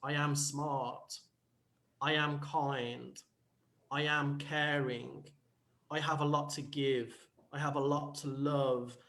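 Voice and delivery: slow, soft, sincere self-talk; hushed, measured words of self-belief; mirror affirmation. Acted. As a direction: tell yourself gently, with pauses between. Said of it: "speaking to yourself in the mirror".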